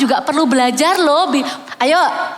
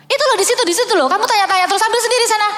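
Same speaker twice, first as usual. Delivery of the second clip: higher, snappish impatient parent; irritated parent; 8 semitones higher, much faster than usual. Retold or imitated. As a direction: brush the child off, annoyed. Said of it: "kita ngomongnya nggak enak". (orang tua kesal dan ketus)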